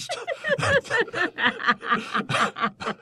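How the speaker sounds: squealing laugh; bursting into high laughter. Real, not acted. Cracks up at the book.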